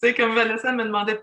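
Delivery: ordinary talk, nothing marked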